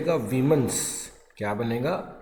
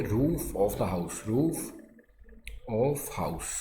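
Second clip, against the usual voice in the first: slow and a bit softer, for writing down; slow soft dictation; 3 semitones lower, 6 dB quieter, much slower than usual. Shown as a demonstration. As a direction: dictate quietly and slowly. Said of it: "क्या लिखेंगे". (धीमी आवाज़ में धीरे लिखवाना)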